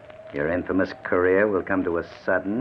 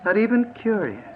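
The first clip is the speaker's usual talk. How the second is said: much higher mocking question; sneering tease; 4 dB louder than usual. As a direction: tease him cruelly. Kidnapper mocks refusal.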